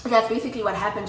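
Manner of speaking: usual speaking voice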